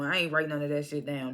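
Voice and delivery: ordinary talk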